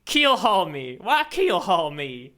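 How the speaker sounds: lightly hammed-up pirate, slow. Host quotes the pirate's line in a mild silly voice. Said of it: "He says".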